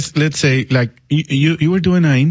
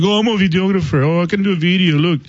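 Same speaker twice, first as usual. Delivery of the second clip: mocking braggart; 6 semitones higher, faster than usual. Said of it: "They're like".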